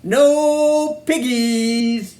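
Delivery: slow drawn-out. A narrator's reading. Drawn-out refrain ending.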